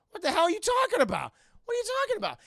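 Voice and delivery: very high, loud incredulous squawk; hammed-up bewildered outburst; mock bafflement. Acting out baffled reaction.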